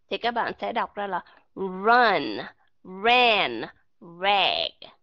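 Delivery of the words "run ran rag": run, ran, rag with lips rounded into u before the r; English r sound. Shown as a demonstration. Teacher showing students the English r in run, ran, rag. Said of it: "các bạn nhớ u cái miệng lại".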